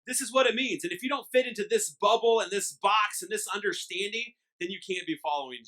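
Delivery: loud, high mock decree; preaching at full force. Pastor mocking legalists with a loud, high decree. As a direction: hammer out the rulebook.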